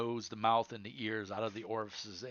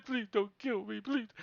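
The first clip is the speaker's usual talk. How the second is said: begging for life; 11 semitones higher than usual. Acted. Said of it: "he starts crying".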